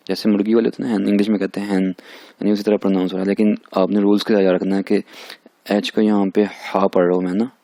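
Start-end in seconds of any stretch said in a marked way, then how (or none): none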